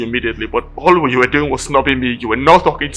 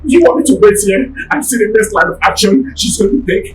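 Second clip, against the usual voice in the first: much higher, louder disbelief; exasperated retort; 9 semitones higher, 3 dB louder than usual. Acted. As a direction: throw the question back at her. Driver argues back in disbelief.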